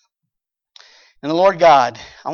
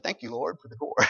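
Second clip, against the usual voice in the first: mock little prayer; 2 semitones lower, 7 dB quieter than usual. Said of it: "Maybe said".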